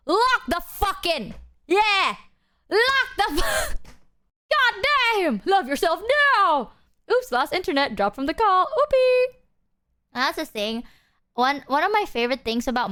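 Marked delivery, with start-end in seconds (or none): 0.1-6.7 high, drawn-out hype yelling; hammed-up motivational shouting; rousing pep talk. Hammed-up motivational speech to chat. 7.1-9.4 high, sing-out fake oops; playful squeaky getaway; mock-innocent excuse. Mock excuse for leaving the call.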